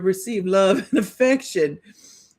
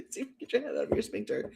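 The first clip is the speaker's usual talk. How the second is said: put-on card voice; 2 semitones higher, 9 dB quieter, faster than usual.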